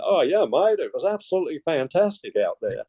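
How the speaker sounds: high, breezy imitation of a broad Australian accent. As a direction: put on a cheery broad Australian accent for the visitors. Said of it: "sorry about the Australian accent".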